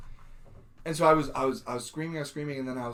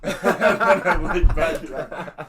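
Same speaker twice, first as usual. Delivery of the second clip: laughing; 3 semitones higher, 11 dB louder, much slower than usual. Real, not acted.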